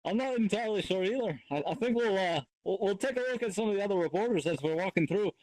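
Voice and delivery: a lightly put-on Scottish accent. Anchor handing over to other reporters, light mock Scottish. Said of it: "everybody's reverted to a Scottish accent".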